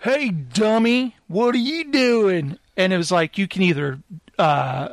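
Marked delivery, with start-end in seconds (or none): 0.0-2.6 high, drawn-out taunting call; slow, pitched-up jeer at a passerby; heckling townsperson. Acted. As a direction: call out mockingly, stretching the words. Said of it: "This guy goes".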